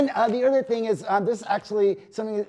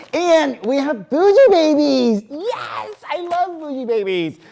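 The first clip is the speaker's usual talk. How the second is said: excited announcement; 9 semitones higher, 7 dB louder than usual. Real, not acted. Announcing pet segment excitedly.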